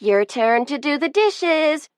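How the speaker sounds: put-on high, gleeful crow of a teenage girl. A narrator's reading. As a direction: crow it like you just won. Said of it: "she crowed".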